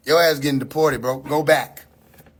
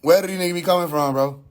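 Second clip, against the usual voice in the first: quick question, falling away at the end; exasperated repeat. Real, not acted. Streamer repeating his question about lookalikes, brisk and exasperated. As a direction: ask it fast in an everyday pitch.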